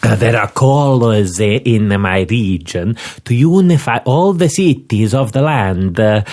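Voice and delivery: put-on a pompous Milanese inspector in an Italian accent. Inspector introduces himself to Scotland Yard, Italian accent. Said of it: "to do the accent".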